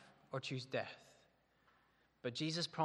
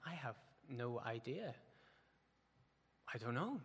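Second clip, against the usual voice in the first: quiet bewildered reply; 2 semitones lower, 7 dB quieter than usual. Retold or imitated. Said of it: "I looked at him and went".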